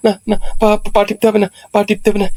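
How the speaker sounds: high, stammering string of protests; panicked refusal. Acted. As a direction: beg off in a fluster, stumbling over repeats. Husband refuses painful massage in panic.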